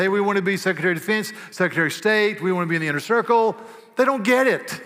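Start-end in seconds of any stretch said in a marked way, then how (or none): 0.0-3.6 eager disciples. Acted. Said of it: "some of them came forward and said".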